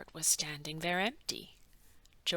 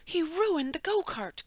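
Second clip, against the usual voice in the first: complaining; 9 semitones higher, 4 dB louder than usual. A narrator's reading. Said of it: "complained another".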